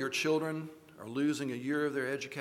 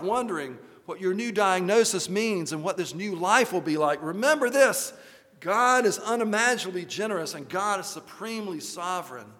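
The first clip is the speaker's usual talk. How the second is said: louder, rising refrain sermon; rousing preaching; 5 semitones higher, 5 dB louder than usual. Acted. Preacher leads the congregation in a refrain, fervent. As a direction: build each refrain with conviction.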